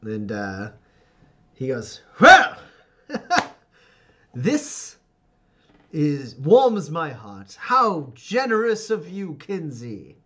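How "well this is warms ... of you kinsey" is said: booming high delight turning to stern, slow orders; expansive patriarch. Acted. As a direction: gush grandly, then instruct with authority. GM voices Ferdinand pleased, then commanding.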